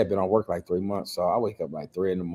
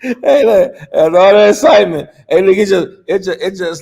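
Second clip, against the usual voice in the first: overcome; 11 semitones higher, 12 dB louder than usual. Real, not acted. Overcome retelling.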